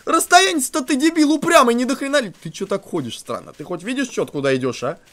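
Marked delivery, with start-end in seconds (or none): 0.0-2.3 high, loud mock scolding, slowed; exasperated yell at the stubborn Tinky Tank; laughing indignant yelling. Real, not acted. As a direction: tell off the dumb monster, half laughing. Scolds monster closing in.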